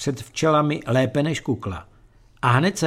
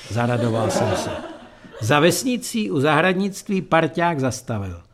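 ordinary delivery, nothing marked

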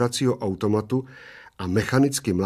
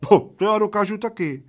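put-on high squeal of a tiny old man; little old man squealing that he can do it too; 11 semitones higher, 4 dB louder than usual. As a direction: squeal it out in a jealous boast. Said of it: "zaječel maličký".

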